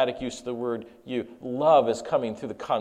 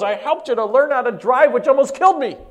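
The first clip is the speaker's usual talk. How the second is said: much higher and louder, near shouting; climactic shout; 10 semitones higher, 8 dB louder than usual. Acted. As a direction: hit the peak of the list. Climax of father's list.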